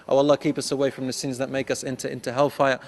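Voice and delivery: ordinary talk, nothing marked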